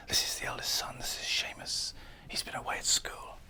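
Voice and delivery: hushed gossiping whisper of mourners. Retold or imitated. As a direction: whisper it behind your hand about the boy. Imitating mourners whispering in background.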